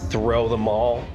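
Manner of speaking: ordinary delivery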